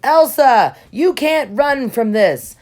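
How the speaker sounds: high, loud menacing call. A narrator's reading. Hans chasing Elsa, calls out loud and high. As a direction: stop her from running with a loud threat. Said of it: "Hans said to Elsa".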